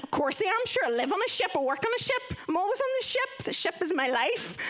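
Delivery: high-pitched, protesting reassurance; over-insistent. Acted. Daughter assuring dad she's on ship, over-insistent. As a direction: pile up assurances, pitched well up.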